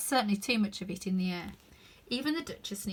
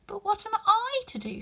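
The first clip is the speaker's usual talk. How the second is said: pleading Alice; 12 semitones higher, 5 dB louder than usual. A narrator's reading.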